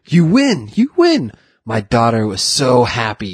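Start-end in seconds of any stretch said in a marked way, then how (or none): 0.1-0.7 higher, delighted cry; excited. Retold or imitated. Candy maker telling the boy he won, delighted. 0.8-1.3 much higher, ecstatic shout. Retold or imitated. Candy maker repeating the win, ecstatic high shout.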